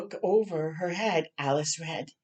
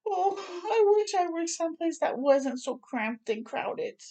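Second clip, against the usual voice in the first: much higher, pleading; high whiny wish; 11 semitones higher than usual. Acted. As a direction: wish yourself free of the tangle. Girl tangled in seaweed.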